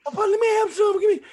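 put-on high, laughing childish plea of Papa, let me have some; begging grandkids. Grandfather mimics grandkids begging for his pie, high and laughing.

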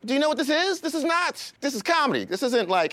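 high-pitched incredulous protest; worked-up, high exasperation; exasperated rant. Real, not acted. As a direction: pitch it way up, as if pleading for sense. Exasperated rant at comedy complainers.

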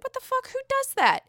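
high mock outrage; imitating Mary. Mary in her confessional, high mock outrage. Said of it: "she's like".

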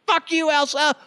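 hammed-up fury at a cartoon song; mock rage. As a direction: yell it like the soundtrack just blew your ears out. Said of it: "you're like".

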